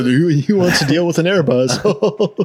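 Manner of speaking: high, slow, loud mock disbelief; mock incredulous. Joking mock question.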